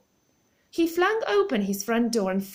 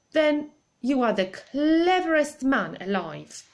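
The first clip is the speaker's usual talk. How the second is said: put-on admiring old lady; 5 semitones higher, 5 dB louder than usual. A narrator's reading. Missus Silver touching his arm.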